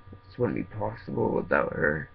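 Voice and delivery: choked up and breaking into tears; fighting back tears while speaking; real tears, voice cracking; crying. Real, not acted. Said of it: "I don't mean to cry".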